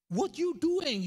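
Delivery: loud, high, snapped officer's challenge; barked question. Retold or imitated. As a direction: bark it like a stern border officer. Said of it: "dibentak saya". (petugas membentak dengan keras)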